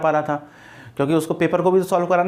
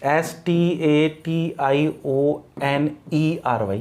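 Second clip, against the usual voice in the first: S T A T I O N E R Y, every letter slow and separate; slow spelling out; 2 semitones lower, much slower than usual. Shown as a demonstration. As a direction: dictate the letters one by one. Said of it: "इसकी भी spelling गलत है".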